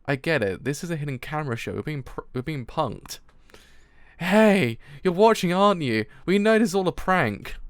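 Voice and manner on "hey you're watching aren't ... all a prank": playful raised-voice accusation; mock calling out. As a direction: call out the hidden pranksters, loud and higher. Calling out supposed hidden camera crew.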